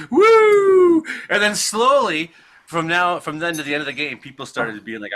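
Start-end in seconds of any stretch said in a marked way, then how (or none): 0.1-1.0 very high, loud "woo"; piercingly high, drawn-out cheer; triumphant whoop. Real, not acted. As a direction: let out a big celebratory whoop. Cheer after the story's payoff.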